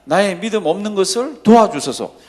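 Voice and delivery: loud, slow start to a retold plea; crying out. Father begging Jesus for help, loud outcry. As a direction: shout it out, stretching the first words. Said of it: "큰 소리를 지릅니다".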